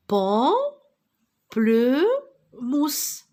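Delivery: Pamplemousse stretched very slowly, syllable by syllable. Shown as a demonstration. Teacher clapping syllables for pupils, very slow. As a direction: pull Pamplemousse apart into its beats. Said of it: "c'est un pamplemousse".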